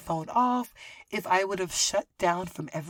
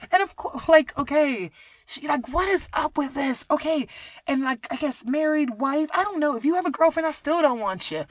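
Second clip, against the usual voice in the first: worked up; 7 semitones higher, 7 dB louder than usual. Real, not acted. Worked-up rant about him.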